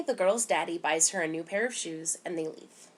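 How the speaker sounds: ordinary delivery, nothing marked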